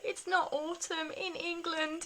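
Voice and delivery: high, slow, drawn-out tearful whine; mock sob, self-mocking; imitating crying. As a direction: stretch it out like an inconsolable child. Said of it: "just crying my eyes out".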